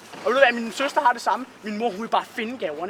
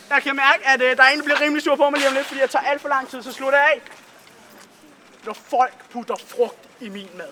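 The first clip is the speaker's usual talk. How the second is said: ordinary delivery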